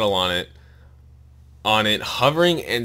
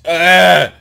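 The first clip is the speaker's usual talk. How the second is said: 1 frustrated yell; 7 semitones higher, 13 dB louder than usual. Real, not acted. Reader cries out in frustration.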